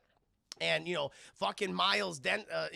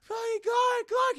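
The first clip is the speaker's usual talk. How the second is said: much higher, louder shocked cry; mock gasp of shock; 8 dB louder, much slower than usual. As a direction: squeal his name in shock. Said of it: "She's like".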